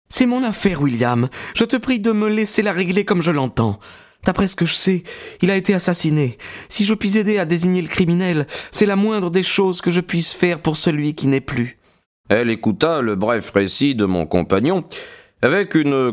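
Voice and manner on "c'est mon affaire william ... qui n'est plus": put-on higher, resolute young woman's voice; firm young woman. A narrator's reading. Maud rebuking her brother William, firm young woman's voice. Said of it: "lui décocha un regard vif, féroce".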